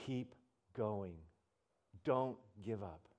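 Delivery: soft, low encouraging voice; quiet encouragement. Retold or imitated. Voice from behind motivates, softly encouraging. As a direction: gently urge them on. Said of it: "motivate you".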